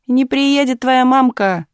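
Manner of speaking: high, slow gloating taunt. A narrator's reading. Boy on the fence gloating at the girl, slow taunt. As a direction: draw it out to gloat over her. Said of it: "злорадно поведал с забора Ипат".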